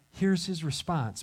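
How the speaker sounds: ordinary delivery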